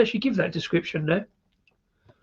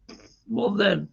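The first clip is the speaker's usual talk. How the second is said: put-on slow, drawn-out Scottish accent; playful Scottish accent put on while reading beer names; 3 semitones higher, much slower than usual. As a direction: drag it out in a broad Scottish accent. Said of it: "when I do a Scottish accent".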